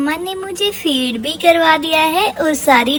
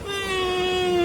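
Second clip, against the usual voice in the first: quiet whimpering hum; soft whimper; 2 semitones higher, 11 dB quieter, much slower than usual. Pretend. Sick child whimpering to mom, soft hum. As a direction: let out a little sick whimper.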